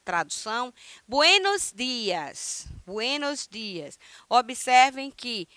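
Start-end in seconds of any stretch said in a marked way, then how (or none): 1.1-2.7 slow, carefully drawn-out greeting; slow model greeting. Shown as a demonstration. Teacher slowly models the greeting for students, slow and deliberate. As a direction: spell it out slowly for the class. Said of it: "teacher models the greeting slowly".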